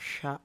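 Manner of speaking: ష said further back, tongue curled; sound from the back. Shown as a demonstration. Teacher demonstrating to students, ష made from further back. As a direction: retroflex "ṣa" for ష. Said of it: "వెనక నుంచి పలుకుతాం".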